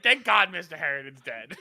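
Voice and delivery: mock old man. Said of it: "Kevin's like".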